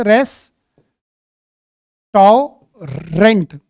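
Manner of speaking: "रेस्टोरेंट" drawn out slowly in separate parts; syllable-by-syllable memory aid. Shown as a demonstration. Teacher showing students how to remember "रेस्टोरेंट", syllable by syllable. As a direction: break "रेस्टोरेंट" into long, slow syllables. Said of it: "ऐसे याद रखो".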